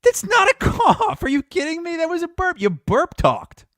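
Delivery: high, loud disbelieving cry; can't believe what he hears; incredulous. Real, not acted. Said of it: "disbelieving outburst at friend".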